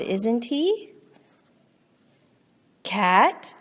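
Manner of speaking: ordinary delivery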